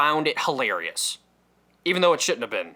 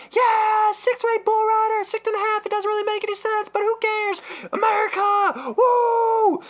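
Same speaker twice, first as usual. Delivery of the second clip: high, shouted crowd cheer. Retold or imitated. Comedian voices the crowd cheering the bronco rider, shouted. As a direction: yell it like a hyped fan.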